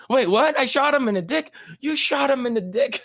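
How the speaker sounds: put-on high shocked kid voice, laughing at the end; shocked Butters. Butters realizing what he did, high shocked voice. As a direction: gasp it out high and startled. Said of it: "He's like".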